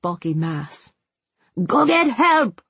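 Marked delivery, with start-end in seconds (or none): 1.6-2.6 high, forceful cry for help; urgent yell. A narrator's reading. Father sending his daughter for help, yelled. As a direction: yell the order, pitch jumped way up. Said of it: "he yelled".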